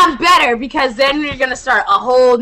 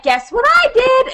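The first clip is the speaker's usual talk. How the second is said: put-on higher, gleeful kid-sister voice; sing-song sister bragging; mimicking sister; 11 semitones higher than usual. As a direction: play the proud little prankster. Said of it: "Manny's like".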